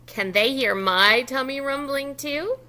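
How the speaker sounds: normal talking voice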